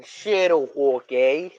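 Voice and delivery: slow, drawn-out mock English accent on Shadow Hulk, eh. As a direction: slowly imitate a friend's English accent. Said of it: "That little English accent".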